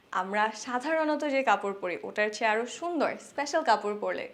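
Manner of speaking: ordinary talk, nothing marked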